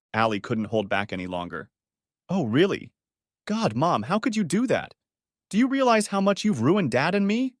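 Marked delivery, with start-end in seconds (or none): 2.3-7.5 higher, rising outrage; outraged daughter. Acted. Daughter attacking her mother, higher outraged voice. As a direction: throw her hurt back at Mom.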